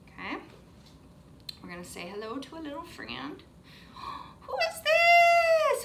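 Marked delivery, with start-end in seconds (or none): 4.4-5.8 gasp, then high, drawn-out question; exaggerated high, loud amazement; wondrous surprise. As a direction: marvel at a surprise guest for little kids. Revealing a surprise friend to children.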